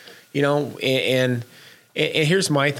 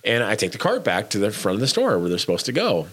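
ordinary talk

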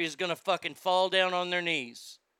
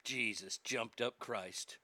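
low, slow muttered curse; exasperated oath; 8 semitones lower, 11 dB quieter, slower than usual. Real, not acted. Host reacting to the story, low slow exasperation. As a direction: drop it under your breath in disbelief.

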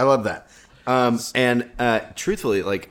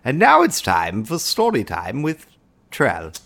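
announcer voice; 9 semitones higher, slower than usual. Acted. Announcing the story segment.

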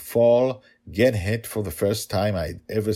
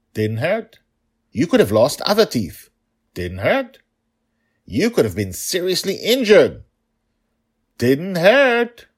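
put-on stubborn child; 10 semitones higher, 9 dB louder than usual. Daughter keeps repeating defiantly.

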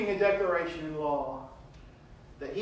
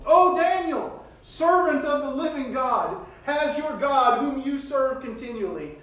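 high, loud, slow cry of anguish; 10 semitones higher, 9 dB louder than usual. Acted. King crying out to Daniel at the lions' den in anguish. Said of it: "he cried out with a tone of anguish".

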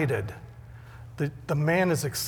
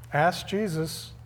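ordinary delivery

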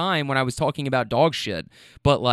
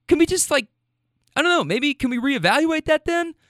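much higher pleading pitch; mock-tentative plea; 11 semitones higher than usual. Real, not acted. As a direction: pitch it way up, as if timidly suggesting. Mock-tentative plea to rule followers.

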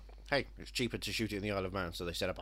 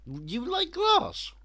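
drawn out and much higher in disbelief; incredulous; 7 dB louder, much slower than usual. Real, not acted. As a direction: stretch it out, disbelieving. Incredulous reply to film pick.

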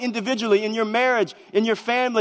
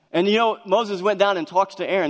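normal talking voice

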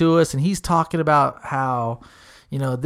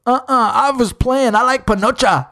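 mock goofy blurt; 7 semitones higher, 5 dB louder than usual. [name] blurts joke loudly.

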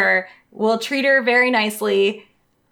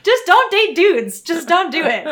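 laughing PSA; 8 semitones higher, 5 dB louder than usual. Real, not acted.

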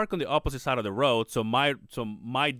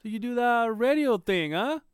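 higher, slow imitation of the guy; how he talks; 9 semitones higher, slower than usual. Retelling the nosy guy's questions, in a higher, slower imitation of him. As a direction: mimic his way of talking, pitched up and slowed. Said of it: "and this is how he talks".

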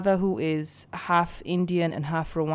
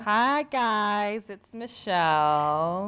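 lightly put-on cute, drawn-out greeting; cute little voice; 4 semitones higher, 4 dB louder, much slower than usual. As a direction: greet listeners in a sweet little singsong. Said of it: "heard her cute little voice".

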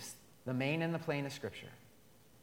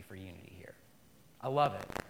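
whisper; 7 semitones lower than usual. Acted. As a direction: drop to a whisper. Hushed aside in sermon.

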